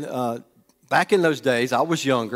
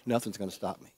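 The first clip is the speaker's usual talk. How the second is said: usual speaking voice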